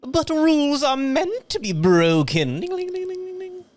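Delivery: high, far-off mimic of another voice; mimicking Herbal's voice. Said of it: "I ring the bell and I go".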